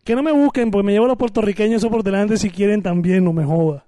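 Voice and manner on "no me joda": ordinary delivery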